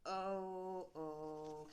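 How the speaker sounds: ordinary delivery, nothing marked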